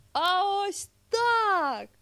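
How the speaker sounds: high, loud, long-stretched words; gleeful demonstrating shout. Acted. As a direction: call it out while launching into a leap. Bear demonstrates by leaping off cliff.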